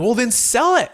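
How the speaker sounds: mock-exasperated wife, pitched high; exasperated urging. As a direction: retell a wife's insistent push, voice jumping up. Wife urging sale in retold dispute.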